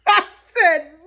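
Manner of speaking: laughing it out loud. Real, not acted. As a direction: crack up before speaking. Laughing at Bill's reply.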